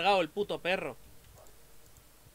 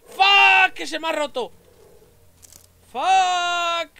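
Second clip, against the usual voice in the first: much higher, much louder screamed curse; real fright shriek; screaming in panic; panicked scream; 13 semitones higher, 13 dB louder, much slower than usual. Real, not acted. Light breaks during attack.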